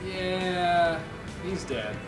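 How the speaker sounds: usual speaking voice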